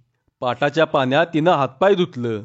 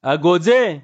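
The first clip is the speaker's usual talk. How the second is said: calling out; slower than usual. A narrator's reading. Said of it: "तायानं पुन्हा हाक मारली".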